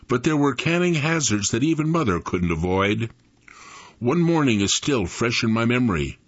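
ordinary delivery, nothing marked

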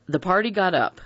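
ordinary delivery

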